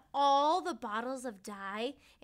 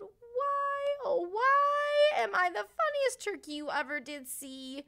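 crying turkey; 9 semitones higher, 7 dB louder than usual. A narrator's reading. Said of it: "Timmy cried".

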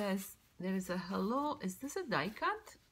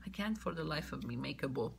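usual speaking voice

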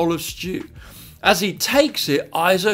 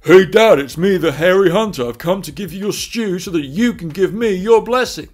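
loud, hearty opening, settling a little; son posing as brother; 6 dB louder than usual. Acted. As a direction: announce yourself boldly to your dad. Jacob posing as hairy hunter brother.

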